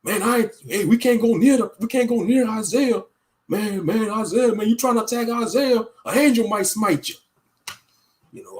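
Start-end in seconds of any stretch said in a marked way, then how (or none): 0.0-7.1 higher, anxious warning about Isaiah; a jittery bystander begging others to stay away; half-hearted, lightly voiced frightened local; spooked townsperson. Imitates scared people about Isaiah.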